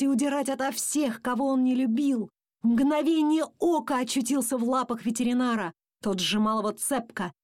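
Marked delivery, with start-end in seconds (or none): none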